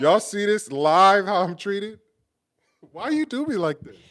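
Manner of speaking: high, loud, drawn-out whine of complaint; playful outraged protest. Real, not acted. Complaining to live viewers about partner's treatment, playful outraged protest. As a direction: appeal to the audience as the wronged party.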